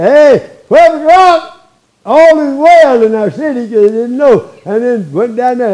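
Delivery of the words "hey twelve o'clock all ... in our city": loud, high town crier's call. Acted. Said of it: "people went through there and declared".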